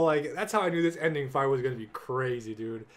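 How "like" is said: normal talking voice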